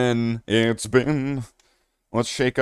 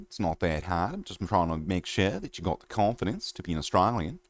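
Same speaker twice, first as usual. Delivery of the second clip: soft mock Australian accent, lower; 3 semitones lower, 7 dB quieter than usual. Shown as a demonstration. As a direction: coach them in a light Australian twang. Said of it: "quite a convincing Australian accent".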